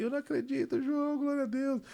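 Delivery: high-pitched, overjoyed imitation of sister; emotional sister. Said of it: "já ficou toda emocionada".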